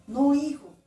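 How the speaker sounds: loud, high opening rebuke of a son; admonishing father. Acted. Patient father warns his straying son again, acted admonishing voice. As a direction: firmly correct the boy, acting the parent. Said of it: "Le vuelve a decir".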